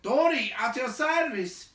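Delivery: high, loud dwarf greeting. A narrator's reading. Second dwarf bowing to the big man, high greeting. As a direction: chirp out a bow. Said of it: "said the two dwarves".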